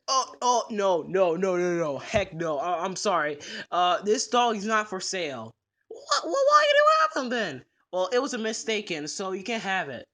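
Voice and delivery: low, gruff pet store manager refusing; grumpy manager saying no; low shopkeeper voice. Acted. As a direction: play the stubborn manager down low.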